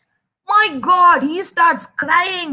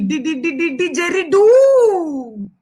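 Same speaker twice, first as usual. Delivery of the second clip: plain reading voice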